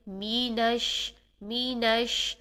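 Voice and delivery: می نش with a full ee vowel, slow; می, full kasra ee; full mee. Shown as a demonstration. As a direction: stretch می into a clear ee. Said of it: "پورا می ادا کریں گے".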